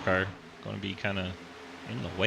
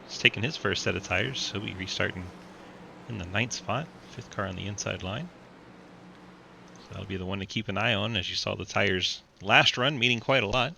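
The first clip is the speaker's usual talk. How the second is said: race commentary. Acted. Live race play-by-play.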